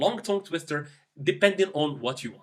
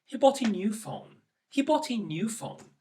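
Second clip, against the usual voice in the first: softer, jealous sad read; 3 semitones higher, 4 dB quieter than usual. Shown as a demonstration. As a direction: sigh it out jealously. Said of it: "in a sad tone".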